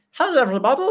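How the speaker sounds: very high-pitched frog character voice; little frog person. Acted. Player trying out a campaign character, little frog voice. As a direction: pipe up as a little game creature. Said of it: "this little frog person".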